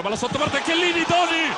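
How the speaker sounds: high, loud rush of commentary; excited shout. Real, not acted. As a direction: yell the save at full voice. Close chance saved by keeper.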